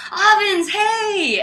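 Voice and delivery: high, loud happy hail; imitated excited greeting. Man keeps seeing her at the event, excited greeting. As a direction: call out as if spotting a friend.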